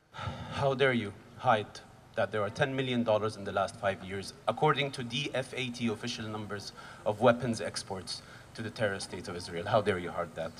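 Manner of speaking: low, grave accusation. Real, not acted. Rally speaker accusing government over weapons exports, low and grave.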